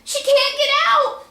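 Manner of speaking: high, loud, slow desperate cry; panicked cry. Acted. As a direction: cry out in panic for the trapped child. Mother panicking at the grave.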